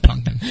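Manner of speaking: "pumpkin" as punkin; mispronounced pumpkin. Shown as a demonstration. Co-host rejecting the wrong version, saying punkin. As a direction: turn the middle of "pumpkin" into n-k: punkin.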